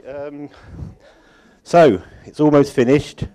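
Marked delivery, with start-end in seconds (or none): none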